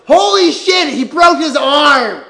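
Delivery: loud, shocked cry at the TV; shocked outburst. Real, not acted. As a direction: react to a gruesome injury, loud and slow. Shocked at injury on TV.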